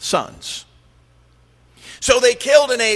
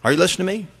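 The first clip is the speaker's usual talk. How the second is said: normal talking voice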